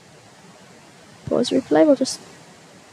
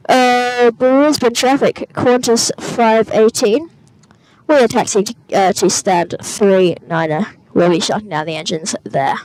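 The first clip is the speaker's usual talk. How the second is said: pilot radio call; 3 dB louder than usual. Acted.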